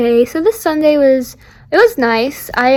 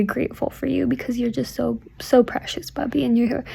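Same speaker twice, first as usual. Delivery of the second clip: soft whisper; 9 dB quieter than usual. Real, not acted. Tender message to her sister.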